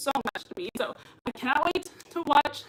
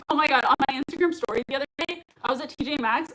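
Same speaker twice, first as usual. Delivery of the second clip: ordinary talk, nothing marked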